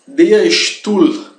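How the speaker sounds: "Der Stuhl" slow, with Stuhl starting "sht"; German SH for S. Shown as a demonstration. Teacher introducing the word for chair, German sh pronunciation. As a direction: model "Stuhl" as "shtool", firmly. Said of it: "quase sempre se converte em sh".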